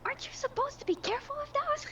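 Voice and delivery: very high, quick worried voice; high character voice. Acted. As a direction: squeak it out fast and worried. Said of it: "Red is going like".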